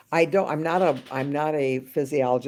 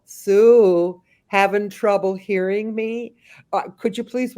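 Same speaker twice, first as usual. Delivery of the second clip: louder call; 6 semitones higher, 6 dB louder, slower than usual. Retold or imitated. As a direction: raise the voice on her name, higher. Calling her louder up close.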